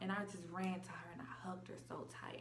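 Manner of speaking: soft unvoiced retelling of the hug; hushed whisper. Real, not acted. Telling viewers about the reunion, in a hushed whisper. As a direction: whisper it, breathless with emotion.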